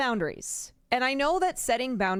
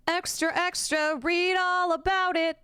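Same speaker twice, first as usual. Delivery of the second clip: Newsies-style newsboy; 9 semitones higher than usual. Acted. Streamer mocks a presenter auditioning for Newsies, high newsboy call. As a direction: hawk the paper in a high-pitched sing-out. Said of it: "he's auditioning for Newsies".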